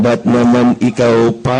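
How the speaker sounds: slow, low mock wail of a grieving father; a hint of tearful lament; imitating a crying father. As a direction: mimic a father sobbing over his son. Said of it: "pag iyak ng iyak, nag-iiba".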